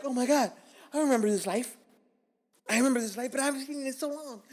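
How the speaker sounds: high, loud, gushing reunion cry; overwhelmed awe. Retold or imitated. Man walking back into club life he missed, overwhelmed high cry.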